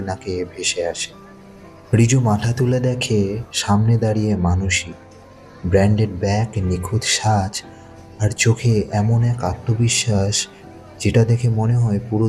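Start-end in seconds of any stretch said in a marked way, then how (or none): none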